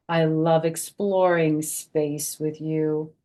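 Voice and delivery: slow dreamy rhyme; reading to children. A narrator's reading. Grandma reading space page to kids, slow and dreamy. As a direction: read it slow and wondering. Reads book text.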